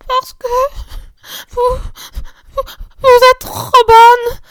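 high, slow, choking sobs through the words; a little girl crying so hard she can barely speak; sobbing child. A narrator's reading. Little Marguerite sobbing to her sisters, high and choked. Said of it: "dit Marguerite qui suffoquait".

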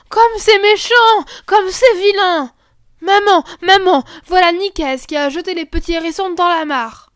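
petite voix aiguë et criée, lente; cri d'enfant indigné. Acted. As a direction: crier au scandale comme une fillette. Fillettes indignées appellent leur maman. (voix d'enfant aiguë et indignée)

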